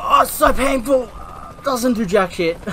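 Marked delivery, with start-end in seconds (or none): 0.0-1.1 sarcastic. Pretend. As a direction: fake hurt, hammed up. Mock pain, not hurt.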